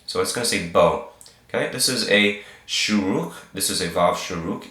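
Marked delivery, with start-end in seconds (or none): none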